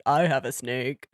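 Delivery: low, quiet imitation of a man; mock man's voice. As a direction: drop your pitch to mimic him. Retelling the man's snake line.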